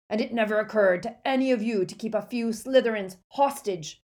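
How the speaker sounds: put-on loud scolding old barman; gruff barman. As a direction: scold them like a grumpy innkeeper. Aberforth scolds the students.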